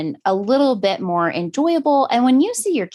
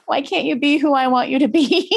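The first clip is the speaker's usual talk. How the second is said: hurt daughter; 5 semitones higher than usual. Retold or imitated. Daughter quoted, accusing.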